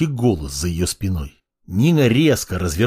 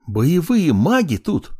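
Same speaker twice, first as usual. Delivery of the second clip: slow, awed exclamation; excited; 7 semitones higher, slower than usual. Acted. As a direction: announce it in awe. Masha spots battle mages.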